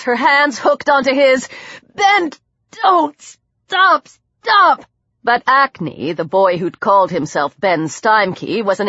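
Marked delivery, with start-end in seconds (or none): 1.9-4.8 high, urgent cries in short bursts; desperate plea. Acted. Heroine to Ben as he attacks her, desperate plea. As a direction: beg him to stop, pitched up sharply.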